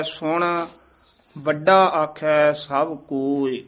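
"ਸੁਣ", clear stop, then slow "ਵੱਡਾ ਆਖੈ ਸਭ ਕੋਇ"; pause after ਸੁਣ. Shown as a demonstration. Teacher showing the pause, slow deliberate reading. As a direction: rest after "ਸੁਣ", stretch the remainder. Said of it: "ਸੁਣ ਤੇ ਵਿਸਰਾਮ ਲਾਉਣਾ ਹੈ".

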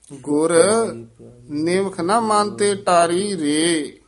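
ਗੁਰ alone, clear halt, then the rest in one flow; pause after ਗੁਰ. Shown as a demonstration. Teacher corrects the pupil's phrasing, clear pause after ਗੁਰ. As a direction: halt on ਗੁਰ before ਨਿਮਖ. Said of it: "ਗੁਰ, ਇੱਥੇ ਯਮਕ ਲੱਗ ਜਾਏਗੀ".

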